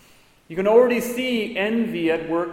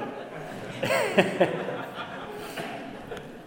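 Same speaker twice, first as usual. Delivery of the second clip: laughter; 5 dB quieter than usual. Real, not acted. Laughing at the joke.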